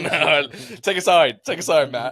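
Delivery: laughing plea. Real, not acted. Friends urging a pick, laughing.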